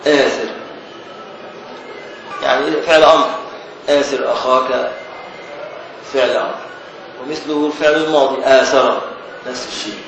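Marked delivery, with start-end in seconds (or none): none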